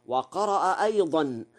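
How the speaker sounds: ordinary delivery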